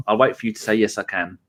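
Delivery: usual speaking voice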